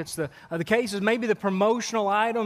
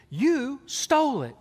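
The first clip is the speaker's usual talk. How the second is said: higher, slow, mock-stern mother; mom accusing; 7 semitones higher, slower than usual. Mother to her four-year-old, mock-stern and slow. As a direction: spell out the accusation slowly. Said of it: "And she".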